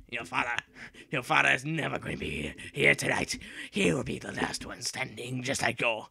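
lightly hammed-up movie villain threat; understated sinister taunt; dramatic villain voice. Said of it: "I, I, I speak natural".

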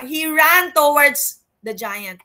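slow, loud "He ran towards the giant"; preacher exhorting the church with David's courage; emphatic. Real, not acted. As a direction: drive it home. Preacher climaxes David story.

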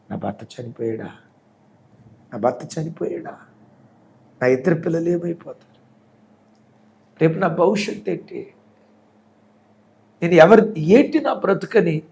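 the wife breaks down, rising into lament; wailing widow. Retold or imitated. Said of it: "పిచ్చి తెచ్చినట్లుగా ఏడుస్తుంది". (భర్త చనిపోయాడని విన్న భార్య, గుండె పగిలేలా ఏడుపు)

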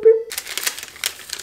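high breathy unvoiced boop, like a police siren chirp; tiny mock police whoop; imitated siren boop. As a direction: mimic the cop car's little chirp. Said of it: "you just hear a little boop".

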